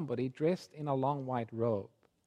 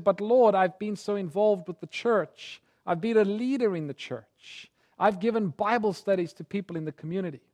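protesting prayer; 6 semitones higher, 4 dB louder than usual. Retold or imitated. Grandpa protests to the Lord.

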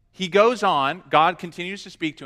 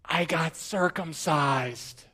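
slow, hushed, secretive confession; imitated confidential whisper; 4 semitones lower, 9 dB quieter, slower than usual. Student confiding in his teacher privately, imitated whisper. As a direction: whisper it slowly so nobody else hears. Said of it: "didn't wanna say it in public".

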